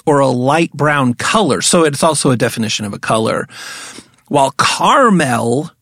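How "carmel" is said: caramel said slowly as "car-MEL"; two syllables in caramel with a full "mel": car-MEL; place-name caramel. Shown as a demonstration. Host explains the place name, caramel slowly as car-MEL. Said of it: "the difference between caramel and caramel".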